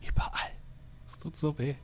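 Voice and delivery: tearful moaning about pain; snotty crying. Acted. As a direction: whimper it, face wet with tears. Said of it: "verheultem Gesicht".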